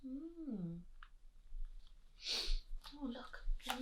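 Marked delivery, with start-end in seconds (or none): none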